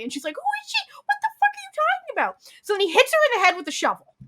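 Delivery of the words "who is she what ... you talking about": much higher, frantic mock-falsetto voice; shrill panic. Wife yelling at her husband at the grave, high and frantic.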